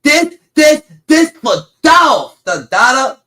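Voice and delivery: loud, high, slow this for Dolph; mock shout. Father yelling at his kid while belting, loud and slow. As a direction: yell it slowly over the belt. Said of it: "guess what I said".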